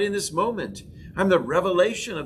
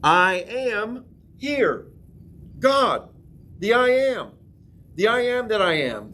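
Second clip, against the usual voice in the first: slow, rising proclamation of faith; proclaiming; 6 semitones higher, slower than usual. Shown as a demonstration. Pastor teaching the congregation to proclaim, slow rising proclamation. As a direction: lift the words up as a proclamation. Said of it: "proclaiming and saying".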